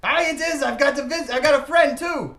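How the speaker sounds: high, loud happy holler back; excited shout. Acted. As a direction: shout your answer to a found friend. Character shouts back happily on reunion.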